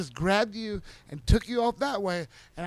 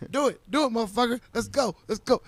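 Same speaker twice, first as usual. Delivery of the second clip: a moderately raised mock taunt; egging him on, slightly louder; taunting dare; 5 semitones higher, 5 dB louder than usual. Retelling taunting a friend to fight.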